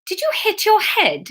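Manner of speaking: high-pitched worried question; concerned. A narrator's reading. Fiona asks Emma after her gibberish, concerned. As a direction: ask with real alarm for a friend. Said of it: "clearly very concerned".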